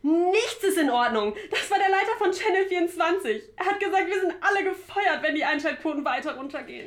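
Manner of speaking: panicked shouting. Acted. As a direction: yell the bad news at her colleague. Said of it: "Schrei nicht so". (panisch schreiend)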